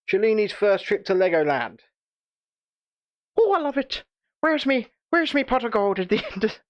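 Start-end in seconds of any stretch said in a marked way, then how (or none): 3.4-6.6 put-on high Irish leprechaun accent; hammy Irish leprechaun, pot of gold. Irish leprechaun voice, then admits.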